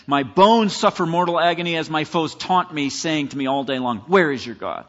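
loud, emphatic scripture recitation; impassioned lament. Acted. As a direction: press through the agony to the taunt. Psalm quote with foes' taunt.

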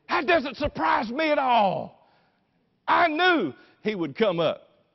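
slow, high, loud declaration; proud exultation. Acted. Mary says she knew her son would rise, triumphant shout. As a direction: proclaim it with total certainty.

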